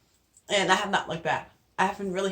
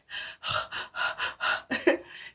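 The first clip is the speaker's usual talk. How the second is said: long breathless sigh, no voice; winded sigh; 5 dB quieter than usual. Real, not acted. Pregnant vlogger apologising to viewers, winded sigh. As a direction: let out a tired, winded breath. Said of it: "I'm winded".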